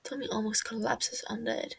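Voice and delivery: usual speaking voice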